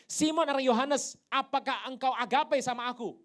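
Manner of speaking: loud, high questioning proclamation; booming challenge. Acted. Jesus questions Peter about agape, booming. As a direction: project the question to the whole hall.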